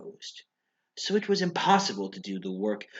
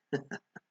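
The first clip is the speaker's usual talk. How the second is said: soft chuckle; 8 dB quieter than usual. Acted. Chuckling at the idea.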